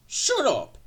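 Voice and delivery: high, loud shouted command; sharp order. A narrator's reading. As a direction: shout them down at once. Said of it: "he ordered".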